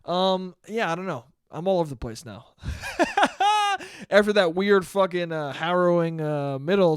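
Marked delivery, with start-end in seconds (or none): 2.6-4.1 bursts into a high, helpless laugh; high cackling laugh. Real, not acted. Cracking up at his own rambling, high laugh. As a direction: laugh high and loose at yourself. Laughs at being all over.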